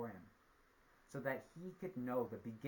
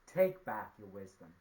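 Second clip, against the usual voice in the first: loud cry, dropping away at the end; 2 semitones lower, 8 dB louder than usual. A narrator's reading. Spider giving up the gourd of wisdom, crying out. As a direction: call it out forcefully, then fall. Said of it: "he cried out".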